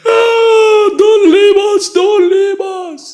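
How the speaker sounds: high, loud mock wail of a grieving family; wailing family plea.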